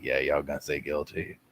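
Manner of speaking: low, soft confiding imitation; mock chummy aside. Mimicking a man chummy with jurors.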